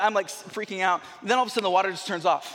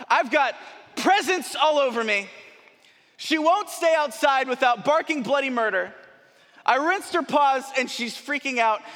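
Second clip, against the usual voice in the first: frantic text; 6 semitones higher than usual. Retold or imitated. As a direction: read it like a panicked plea for help. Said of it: "the most frantic text I've ever sent".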